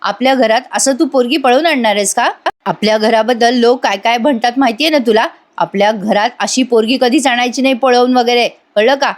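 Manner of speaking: plain reading voice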